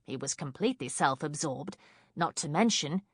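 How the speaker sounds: ordinary delivery, nothing marked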